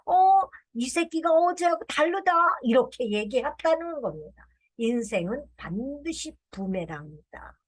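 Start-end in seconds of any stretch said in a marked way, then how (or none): 0.1-2.3 put-on higher, louder bratty kid voice; cheeky grandchild. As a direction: play the cheeky grandson talking back. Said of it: "할아버지한테 그랬답니다".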